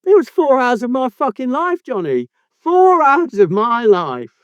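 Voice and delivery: high-pitched aggrieved complaint; exasperated. Real, not acted. Host rails at co-host about wasted hours, exasperated.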